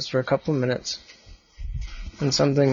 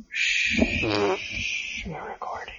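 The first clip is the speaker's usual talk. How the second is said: real whisper; 6 semitones higher, 9 dB quieter than usual. Real, not acted. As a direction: breathe it, no voice at all. Dad hushes during recording.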